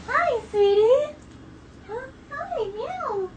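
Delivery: high cooing pet voice. Real, not acted. Cooing to her cat.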